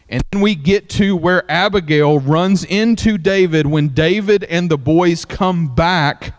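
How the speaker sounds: usual speaking voice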